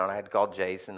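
normal talking voice

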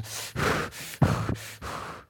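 huffing hard through the nose; seething puffs of air; angry snorting breaths. Acted. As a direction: snort breaths like you're fuming. Acting out flaring-nostril anger.

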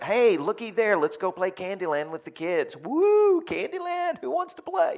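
very high, loud, over-eager invitation; fake cheer. Relative escaping a family argument, falsely cheerful squeal. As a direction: squeal it like a hammed-up distraction.

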